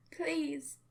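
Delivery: high, loud pleading cry; desperate scream. Acted. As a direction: beg at the top of your lungs. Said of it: "I screamed".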